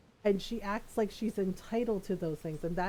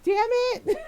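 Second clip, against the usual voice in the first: high, loud mock cry of "Damn it"; mock outburst; 10 dB louder than usual. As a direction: shriek it in playful frustration. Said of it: "Golden's like".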